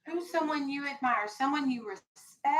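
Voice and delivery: ordinary talk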